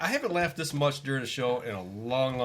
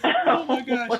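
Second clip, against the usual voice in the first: loud, high words through laughter; laughing hard; 8 dB louder, slower than usual. Real, not acted. Co-host reacting to the fallen foot story, laughing hard. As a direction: gasp it out while cracking up.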